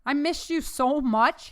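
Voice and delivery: high, slow, emotional words; choked up. A narrator's reading. Son hugging his mother, trying not to cry, choked up. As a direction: stretch it out as if holding back tears. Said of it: "he choked out the words".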